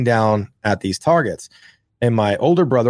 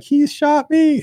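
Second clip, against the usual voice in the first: much higher, slow whining tattletale; whiny little brother; 3 dB louder, slower than usual. Retold or imitated. Brother to mom, pointing out the shooter, in a high slow whine. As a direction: drag it out like a hurt kid telling on you. Brother showing wounded hand.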